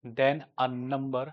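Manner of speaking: slow, word by word dictation pace. Shown as a demonstration. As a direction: dictate it so students can write it down. Dictating a definition. (धीमे-धीमे लिखवाने वाला अंदाज़)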